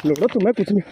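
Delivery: ordinary talk, nothing marked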